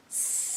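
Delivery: long unvoiced snake hiss; steady breathy hiss like a snake. Shown as a demonstration. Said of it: "take that S snake sound all the way down".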